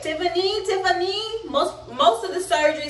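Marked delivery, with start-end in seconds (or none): 0.0-1.4 high-pitched imitation of someone calling a patient; mimicked wake-up call; calling her name. Acts out staff rousing her.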